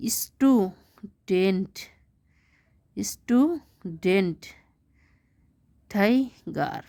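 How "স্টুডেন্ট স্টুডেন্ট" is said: Student drawn out slowly in two beats; syllable split. Shown as a demonstration. Teacher breaking the word for pupils, slow and split. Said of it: "has two syllables".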